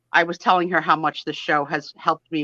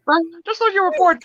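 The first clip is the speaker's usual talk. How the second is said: high, put-on voice mocking the sister; lightly voiced dismissive sister; 12 semitones higher, 4 dB louder, faster than usual. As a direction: ham up the sister's line. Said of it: "That would be my daughter".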